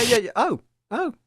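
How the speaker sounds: usual speaking voice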